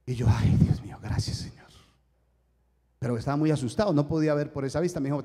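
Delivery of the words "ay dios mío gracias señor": whispered prayer of thanks; hushed relief. Retold or imitated. Patient thanking God after the diagnosis, hushed relief. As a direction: let it out as a breathy, voiceless sigh of thanks.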